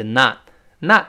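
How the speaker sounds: American "not" with an open ah, naht; American pronunciation. Shown as a demonstration. Teacher shows how Americans say it, American vowel. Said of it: "美国o变成啊".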